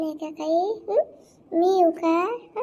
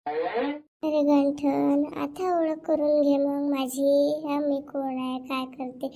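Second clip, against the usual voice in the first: slow coaxing purr; 5 semitones lower, 5 dB quieter, slower than usual. Acted. Seductive woman coaxing Ganthan.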